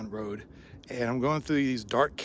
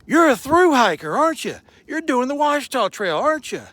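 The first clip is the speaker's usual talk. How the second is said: much higher, louder, thrilled questions; much higher excited camper voice; excited stranger; 11 semitones higher, 10 dB louder than usual. Retold or imitated. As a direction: gush like a delighted fan. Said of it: "he gets all excited".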